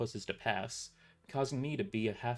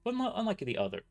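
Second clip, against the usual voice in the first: ordinary delivery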